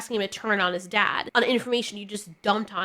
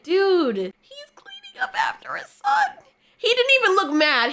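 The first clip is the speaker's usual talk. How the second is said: near tears; 11 semitones higher than usual. Retold or imitated. Moved by the scene.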